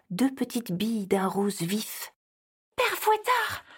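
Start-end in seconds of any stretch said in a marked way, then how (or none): 2.8-3.6 shrill high cry of recognition; frightened cry. Acted. Elf cries out at the sight of Père Fouettard, high and terrified. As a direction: gasp his name in terror.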